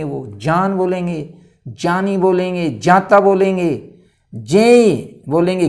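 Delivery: slow, clear "जान", "जानी", "जाता" with a j sound; Sanskrit reading. Shown as a demonstration. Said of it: "कभी भी ज्ञान नहीं बोलेंगे".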